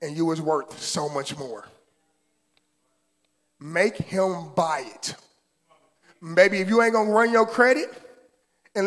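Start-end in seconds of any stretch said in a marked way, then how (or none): 3.6-5.1 slow emphatic. Shown as a demonstration. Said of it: "slow emphatic refrain".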